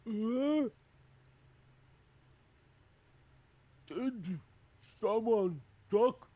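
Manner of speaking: loud, high, slow giant voice; dim hill giant. Acted. Hill giant hears a small being hail him, puzzled bellow.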